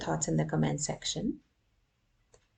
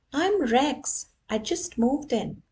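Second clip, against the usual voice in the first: friendly dog voice; 7 semitones higher, 4 dB louder than usual. Acted. Rex introduces himself.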